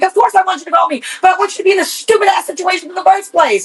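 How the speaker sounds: quick high-voiced parental scolding; mock dad lecture. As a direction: rattle off the dad's lecture.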